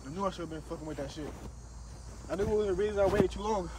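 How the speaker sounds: usual speaking voice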